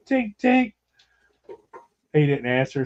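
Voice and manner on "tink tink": high sing-song tink tink, voicing a knock; knocking sound. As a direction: chirp "Tink, tink" high like tapping on a door. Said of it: "and I'm like".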